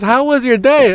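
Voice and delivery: high-pitched holler from afar; imitated mom calling out. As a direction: call a friendly question across a big yard. Mom calls from hundred yards.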